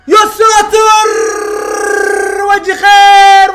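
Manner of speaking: sudden high yell of disbelief, slowed on the last word; shocked shout. Real, not acted. Fan watching Al-Ahli concede early, shocked high shout. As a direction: cry out in shock as the goal goes in.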